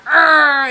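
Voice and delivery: a short loud frustrated growl; loud growl of a fed-up parent. Retold or imitated. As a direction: let out a loud angry grr at the kid. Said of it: "we wanna".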